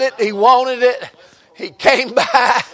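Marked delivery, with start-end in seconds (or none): none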